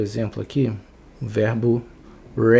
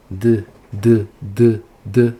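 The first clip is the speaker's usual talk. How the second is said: louder, separate "duh" bursts; voiced D sound; 6 dB louder, much slower than usual. Shown as a demonstration. Teacher proving D is voiced, repeated duh sounds. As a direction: punch out the bare D with the vocal cords buzzing. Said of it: "som de D da letra D".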